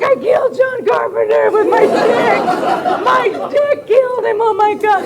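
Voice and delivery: high-pitched, loud comic shriek; shrieking retold alarm, near laughter; mock panic. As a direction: wail it in hammed-up horror. Retold mock panic, he's having heart attack.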